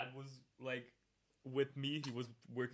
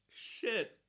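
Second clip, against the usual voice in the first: pained curse; 8 semitones higher, 6 dB louder than usual. Real, not acted. Wasabi burning.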